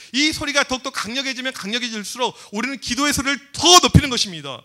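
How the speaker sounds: ordinary talk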